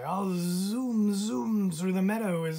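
loud, buzzy, eager; zooming dragonfly. A narrator's reading. Dragonfly eagerly offering to fetch food. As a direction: zoom off with energy.

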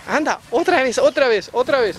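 high, loud, eager urging. Real, not acted. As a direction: egg the little one on. Urging toddler to walk again.

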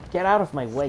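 forceful gamer outburst; angry shout. Real, not acted. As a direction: yell at enemies to clear off. Yelling at enemies blocking path.

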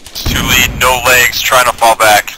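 loud urgent casualty call; urgent shout. Real, not acted. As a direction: call it out hard and fast. Wounded report under fire.

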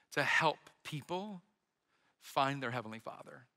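earnest quiet preaching. Acted. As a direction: bring it down to a hushed, heartfelt appeal. Soft closing sermon reflection.

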